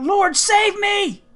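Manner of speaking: high, loud, slow cry for help; desperate cry. A narrator's reading. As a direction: scream it as you sink. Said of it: "cried out".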